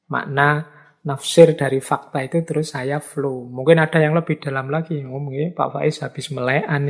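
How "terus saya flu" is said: normal talking voice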